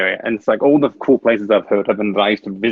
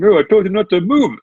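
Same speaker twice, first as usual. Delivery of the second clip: high, louder attempt at a classic New York accent; mock New York accent, pitched up; 10 semitones higher, 4 dB louder than usual. Butcher telling off a customer in line, mock New York accent. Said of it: "in the classic New York accent".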